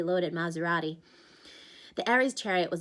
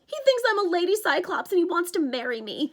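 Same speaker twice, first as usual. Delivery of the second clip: higher, louder anguished satyr; wailing; 11 semitones higher, 7 dB louder than usual. Acted. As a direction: wail the awful news. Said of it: "Grover wailed".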